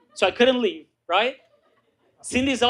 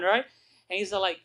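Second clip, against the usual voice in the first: ordinary talk, nothing marked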